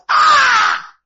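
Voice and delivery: long, very high, loud "Ah!"; preacher's shout. Real, not acted. Preacher at the climax of the verse, long high shout. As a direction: cry out at full voice.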